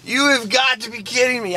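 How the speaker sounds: ordinary talk, nothing marked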